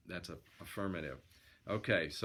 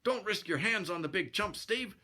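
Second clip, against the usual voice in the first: high-voiced sidekick, mildly disgusted; a touch of scorn, pitched high; 10 semitones higher than usual. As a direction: dismiss the rival in a raised character voice. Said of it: "said Bill disgustedly".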